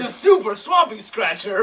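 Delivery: high slow wondering repeat; amazed question. Acted. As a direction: marvel at the name. Customer repeats product name in ad.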